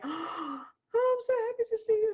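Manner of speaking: high, fast, gushing delight; overjoyed greeting. Acted. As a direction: squeal it as at a joyful airport reunion. Acting a joyful airport reunion.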